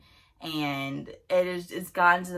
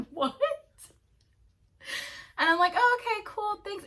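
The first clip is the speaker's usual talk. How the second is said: stunned surprise; 6 dB louder than usual. Retold or imitated. Stunned by drink invitation.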